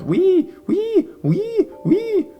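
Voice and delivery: very high, loud wailing siren imitation; siren squeal. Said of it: "squeal in high-pitched sounds of sirens".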